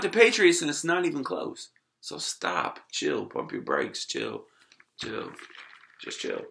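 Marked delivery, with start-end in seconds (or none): 2.0-6.4 much softer and lower, settling down; quiet wind-down. Real, not acted. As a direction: let it drain out, calm them down. Calming after rant.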